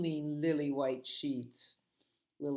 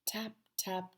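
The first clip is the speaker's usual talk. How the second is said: whispered tapping; 5 semitones higher than usual. A narrator's reading. Storyteller whispering the leprechaun's tapping. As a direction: breathe the taps like a tiny hammer.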